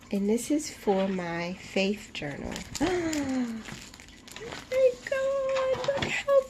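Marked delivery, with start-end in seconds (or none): 2.6-3.8 high gasp of delight; sudden awestruck gasp. Real, not acted. Vlogger revealing the wrap cover, delighted gasp. 4.4-6.4 very high, near-tearful squeal; overjoyed squeal. Real, not acted. Vlogger seeing her wrap cover, overjoyed squeal.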